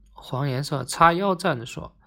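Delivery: plain reading voice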